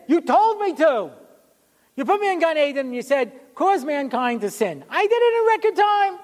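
high-pitched comic outrage; indignant protest. Acted. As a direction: plead your case as the wronged snake. Snake protests its punishment to God.

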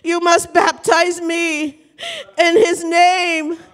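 high, slow, crying-out plea; impassioned retelling with a cry in it; tearful plea. Retold or imitated. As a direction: act a convert begging through tears. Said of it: "he began to cry".

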